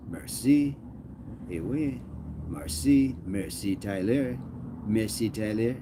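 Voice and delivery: put-on French accent; hammed-up French pronunciation. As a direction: say it like a playful Frenchman. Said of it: "You got the French down".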